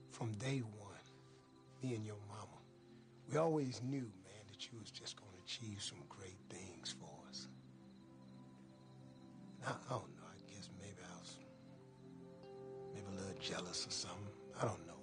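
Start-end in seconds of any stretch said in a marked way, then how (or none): 4.0-11.4 hushed, near-whispered confession; whispering. Acted. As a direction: drop to a whisper, holding back emotion. Father's emotional confession to son.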